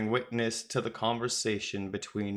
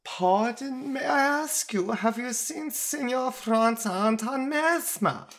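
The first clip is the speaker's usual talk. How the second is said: much higher, hammed-up Italian accent; silvery Italian accent; 14 semitones higher, 3 dB louder than usual. A narrator's reading. Traveler entreats two strangers in a tavern, high silvery Italian accent. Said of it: "A silvery Italian accent".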